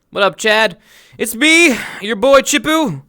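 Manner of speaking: hype greeting. Real, not acted. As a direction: pump up the chat as you greet them. Stream greeting to chat.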